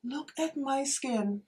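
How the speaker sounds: slow, soft and high in wonder; hushed amazement; awed. Real, not acted. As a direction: marvel at it quietly. Admiring her finished face.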